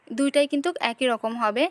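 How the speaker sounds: ordinary delivery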